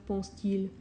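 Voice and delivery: ordinary delivery, nothing marked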